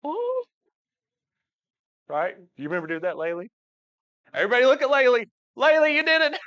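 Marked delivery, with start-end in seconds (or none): 0.1-0.4 very high delighted ooh; high-pitched ooh from a child; child's high ooh. Retold or imitated. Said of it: "you know what Laylee's doing". 4.3-6.4 loud, high cheering; excited praise. Real, not acted. Cheering on a child.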